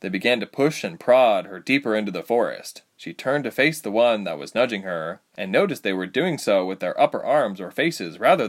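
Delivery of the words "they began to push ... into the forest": ordinary delivery, nothing marked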